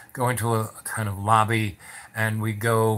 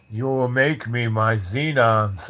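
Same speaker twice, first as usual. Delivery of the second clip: slow, commanding put-on voice; a hint of an imperious matriarch; lightly voiced Madame; 3 dB louder, slower than usual. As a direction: give a slow imperious order. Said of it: "She goes".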